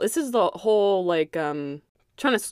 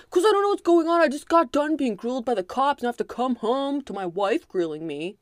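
mock defensive husband; 7 semitones higher than usual. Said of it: "he's all like".

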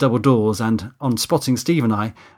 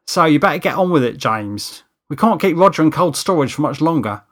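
higher, louder crew mate; lightly voiced Steve, urging; 5 semitones higher, 5 dB louder than usual. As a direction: chivvy a colleague along in a light character voice. Steve urges James on.

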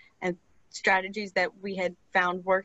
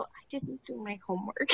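much softer guilty admission; sheepish confession; 10 dB quieter than usual. Retold or imitated. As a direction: own up like a shy pupil. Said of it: "And she'd go".

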